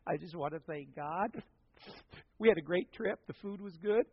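lightly voiced, timid church testimony; imitated girl testifying. Girl gives her first testimony in church, imitated. Quoting girl's teary testimony.

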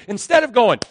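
usual speaking voice

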